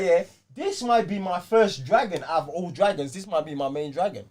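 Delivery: usual speaking voice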